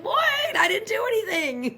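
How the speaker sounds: high-pitched indignant mock outburst; defensive protest. Someone who feels attacked by a partner, loudly defensive. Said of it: "it feels like an attack".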